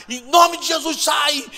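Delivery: loud, high-pitched rebuke; exorcism shout. Retold or imitated. Church members casting out a demon, shouted command.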